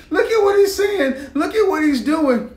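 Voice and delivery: high, loud imitation of women tattling; put-on scandalized complaint, pitched right up; mock tattling women. Women calling on men to defend them, mock scandalized.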